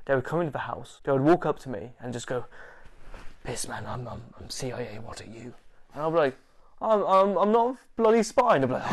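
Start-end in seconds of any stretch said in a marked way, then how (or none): 3.4-5.5 low, very soft undercover confession; hushed mock-covert approach; imitated secretive murmur. Retold covert agent sidling up. 6.8-8.6 high-pitched, stammering protest; mock flustered denial. Retold denial of spying.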